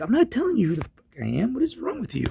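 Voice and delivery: high-pitched retold outburst; indignant refusal. Man refusing police his name, indignant. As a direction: refuse in outrage, voice climbing very high.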